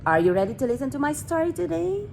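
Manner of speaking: plain reading voice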